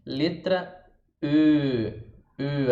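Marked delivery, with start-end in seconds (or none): 1.2-2.7 long rounded "E", like eu; French E. Shown as a demonstration. Teacher introducing the French letter, drawn-out rounded E. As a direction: hold "E" with puckered lips. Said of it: "Aqui é o primeiro grande problema do francês".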